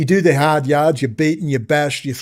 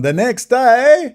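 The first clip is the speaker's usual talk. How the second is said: ominous build-up; 9 semitones higher, 5 dB louder, much slower than usual. Acted. Build-up before mock panic.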